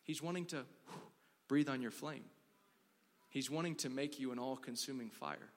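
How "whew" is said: soft puff of breath; short whooshing exhale; blowing breath. Shown as a demonstration. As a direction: blow gently, as on a flame. Mimes breathing on a flame.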